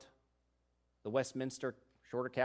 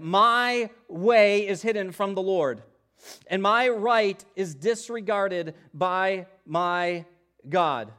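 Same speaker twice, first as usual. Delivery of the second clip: lament; 5 semitones higher, 10 dB louder, slower than usual. Acted. Preacher voicing Israel's lament.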